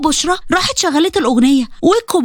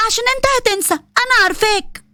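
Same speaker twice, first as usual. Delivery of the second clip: put-on higher girlish voice; teasing girl; 6 semitones higher than usual. Teenage Bushra teasing Qasim, high and girlish. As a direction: tease him like a young girl.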